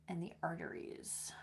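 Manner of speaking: whisper. Real, not acted. Whispered end of a list.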